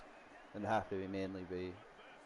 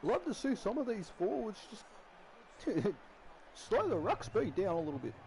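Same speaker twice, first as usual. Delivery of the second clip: high, loud excited rugby league call; Blocker Roach commentator voice; 11 semitones higher, 3 dB louder than usual. Retold or imitated. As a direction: call the try like a fired-up TV commentator. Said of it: "my Blocker Roach impression".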